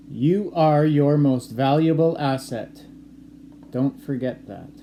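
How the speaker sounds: slow, loud dictation read to the class; steady teacher dictating a quote. Shown as a demonstration. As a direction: read it out clearly so they can write it down. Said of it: "I'm gonna say a quote".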